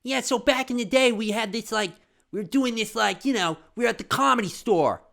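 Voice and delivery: high, loud lisping Marc Maron; Marc Maron impression. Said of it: "he's like, he's like".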